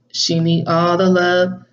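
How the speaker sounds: normal talking voice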